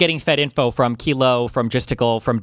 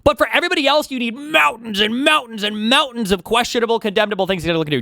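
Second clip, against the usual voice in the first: sarcastic rant; 12 semitones higher, 4 dB louder than usual. Real, not acted. Sarcastic rant about double standards.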